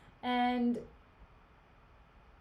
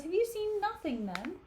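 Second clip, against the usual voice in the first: higher, put-on voice for Othello; Othello questioning; 8 semitones higher than usual. Othello pressing Emilia for the truth, higher suspicious. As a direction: read it as a suspicious husband.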